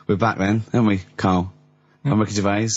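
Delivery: ordinary talk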